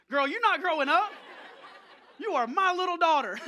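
loud, high-pitched comic outburst; retold dad protest. Dad told his daughter is growing up, loud mock protest.

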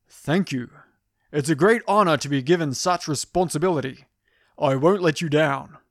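heroic, manly and formal; action hero voice. Retold or imitated. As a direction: answer like a noble action hero. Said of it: "No, I didn't really say it like that".

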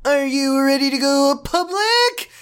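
high, drawn-out cartoonish voice; stretched high mock voice; Josh voice. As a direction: put on Josh, high and slow. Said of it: "did Josh voice".

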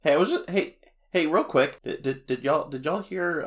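high eager interruption; excited. Real, not acted. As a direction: jump in bursting with news.

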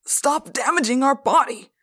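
high strained cry in an altered voice. A narrator's reading. Possessed teacher to his other self, crying out. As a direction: cry out at the one hurting you. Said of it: "Mister Pardew cried".

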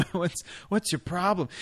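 mock protesting lover.

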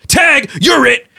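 high, loud playful "tag" shout. Acted. GM as Bob tagging the player, loud and playful. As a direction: yell it like a giant kid at play. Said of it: "He looks down and goes".

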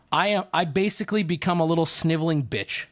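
normal talking voice

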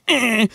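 a high little eh; mock mic bump sound. Shown as a demonstration. Imitating how the mic hit will sound in post, mock eh. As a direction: imitate a mic thump noise. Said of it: "you can hear that in post, and it'll be like".

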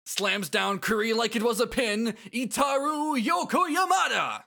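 climax on the name like a ring announcer; shouted arena intro. Acted. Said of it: "bring to this announcer voice".

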